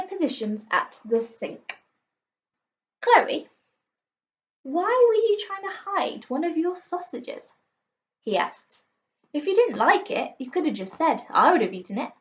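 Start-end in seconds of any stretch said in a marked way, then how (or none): 3.0-12.1 high, lightly voiced dad, puzzled; a light take on Dad, pitched high and gently probing; questioning dad. A narrator's reading. Dad asking Chloe about the hidden sausage, high and gently puzzled.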